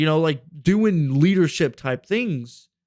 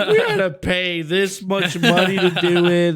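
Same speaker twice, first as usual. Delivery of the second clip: laughing; 4 semitones higher, 4 dB louder than usual. Real, not acted. Retelling friends' complaint, laughing.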